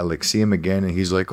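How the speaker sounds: usual speaking voice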